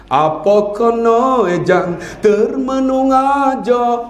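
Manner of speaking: put-on slow Negeri Sembilan accent; slow Negeri Sembilan speech with a far-off voice; Negeri Sembilan dialect. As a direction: drawl it out like a Negeri Sembilan local. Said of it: "tu qiraat tu".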